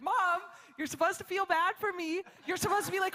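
high-pitched retold protest; frustrated. Daughter wants pity from Mom, acted frustration. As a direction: complain to Mom, pitched well up. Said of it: "I'm, like, frustrated".

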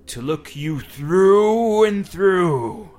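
high, very slow, loud creature voice; creature answering. Acted. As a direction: drag it out menacingly. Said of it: "the creature answers".

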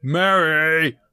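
calling out loud across the tavern; shouted name. Acted. Bartender shouting for Mary. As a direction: bartender calls loudly for Mary. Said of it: "he shouts".

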